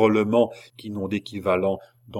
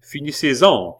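raised, commanding call from the crowd; loud, higher-pitched command; imperious shout; 5 semitones higher, 7 dB louder than usual. A narrator's reading. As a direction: call it out as an order. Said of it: "cria une voix".

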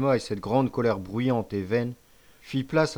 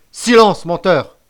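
high, very loud angry bark; cutting command; 8 semitones higher, 14 dB louder, slower than usual. A narrator's reading. Chief cutting off the accused, barked command. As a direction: shut the liar up sharply. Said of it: "coupa le chef".

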